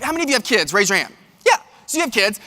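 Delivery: ordinary talk, nothing marked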